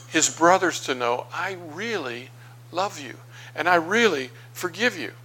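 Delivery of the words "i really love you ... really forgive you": tender reassurance. Acted. Joseph reassures his brothers.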